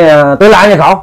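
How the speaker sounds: put-on drunken snapping. Drunk son snapping at his ghost father, irritated. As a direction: brush him off, drunk and annoyed. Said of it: "Thì nạt nộ vậy quá".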